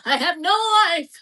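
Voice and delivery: hammed-up crying wail; mock wallowing. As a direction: wail it in exaggerated self-pity. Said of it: "you're wallowing".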